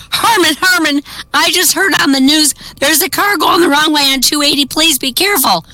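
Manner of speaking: high, urgent wife calling out; urgent warning. A narrator's reading. Wife warns her husband over the car phone, high and urgent. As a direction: pitch it up and rush the alarm. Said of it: "his wife's voice urgently warning him".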